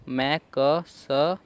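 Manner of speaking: मैं क स drawn out very slowly, one sound at a time; syllable by syllable. Shown as a demonstration. Teacher sounding out मैं क स for students. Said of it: "इसे हम क्या पढ़ते हैं".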